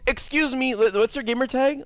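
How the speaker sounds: mock polite. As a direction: sarcastically play an overly courteous player. Mocking an absurdly polite player request.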